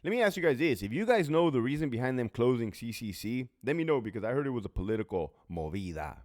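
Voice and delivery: normal talking voice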